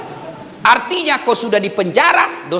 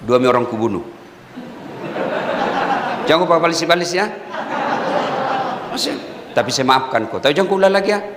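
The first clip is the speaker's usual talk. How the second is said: low, lightly voiced tough-guy threat; a mock menacing growl, half in jest; tough inmate; 8 semitones lower, 3 dB quieter, faster than usual. Preacher imitating a killer warning whoever kicks him, low and mock threatening. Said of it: "pikir-pikir kau dulu".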